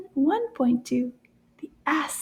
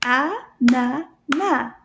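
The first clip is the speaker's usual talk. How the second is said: "A-na-na" very slowly, three separate beats; syllable by syllable; 4 dB louder, much slower than usual. Shown as a demonstration. Teacher clapping out syllables with children, "A-na-na" in separate beats. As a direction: count out "A-na-na" with a pause after each syllable. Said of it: "count the syllables in our words".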